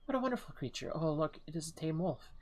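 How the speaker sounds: delighted character voice. Acted. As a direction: gush over the animal in a high voice. Voicing a character meeting a wolf.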